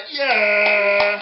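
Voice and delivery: loud, drawn-out crowd cheer; big cheer. Shown as a demonstration. Leading children in a big cheer. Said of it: "give a big cheer and sort of go".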